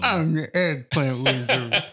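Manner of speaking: slow, droopy mumble; Droopy Dog voice. Acted. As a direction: drag it out like Droopy. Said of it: "It doesn't work".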